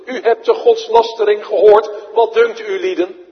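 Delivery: loud, high outraged question to the council; indignant accuser. Acted. High priest roaring at the council for a verdict. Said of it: "Daar is hij van beschuldigd".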